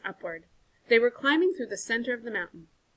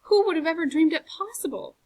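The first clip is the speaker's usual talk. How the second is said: despairing; 9 semitones higher, 6 dB louder than usual. A narrator's reading. Despairing outburst.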